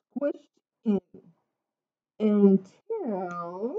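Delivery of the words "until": 引き伸ばした「until」、上がり調子で間を溜める; 「until」をゆっくり伸ばし、語尾を上げて次を待たせる; drawn-out suspense. A narrator's reading. Suspense before the twist.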